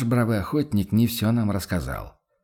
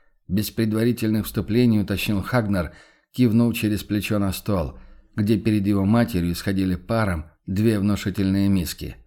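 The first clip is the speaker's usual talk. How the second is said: ordinary delivery, nothing marked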